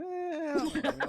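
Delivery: high, slow, laughing drawl. Real, not acted. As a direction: laugh through the words. Joking about missing home.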